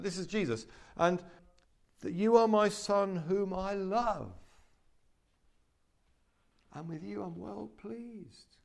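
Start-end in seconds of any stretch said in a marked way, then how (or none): 2.1-8.6 voice of God. Acted. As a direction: let the Father's blessing trail off gently. Preacher voices God's words a third time.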